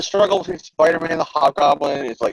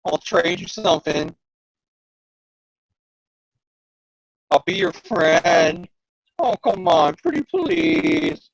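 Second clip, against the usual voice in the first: slow, wheedling mock plea; lightly hammed-up pleading goblin; 3 semitones higher, slower than usual. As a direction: beg like a kid trading cards. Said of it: "He goes".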